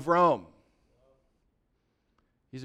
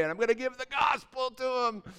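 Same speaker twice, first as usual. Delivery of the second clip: Paul's triumphant cry, higher; bold shout; 7 semitones higher than usual. Acted. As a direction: shout the resolve out. Paul's bold resolve acted out.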